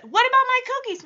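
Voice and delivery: high, quick mock demand; pleading kid imitation. Stephanie demanding help with cookies, high imitated plea. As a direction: pipe up fast, imitating an impatient girl.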